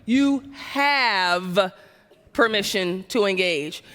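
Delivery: "You" drawn out and pushed, then "permission to engage" falling low; stress on YOU. Shown as a demonstration. Speaker showing the audience a new emphasis on "You". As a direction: lean hard on "You", let the rest drop. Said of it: "same exact words, very different emphasis".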